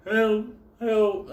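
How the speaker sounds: high, thin retold cry; mock scream for help. Friend drifting off on the ice, mock screaming. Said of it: "and he screamed".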